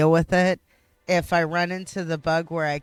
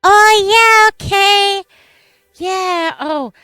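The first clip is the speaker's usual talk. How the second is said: excited squeal; 12 semitones higher, 10 dB louder, slower than usual. Real, not acted. Squealing in sudden delight.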